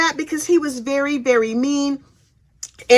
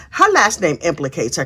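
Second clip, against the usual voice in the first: normal talking voice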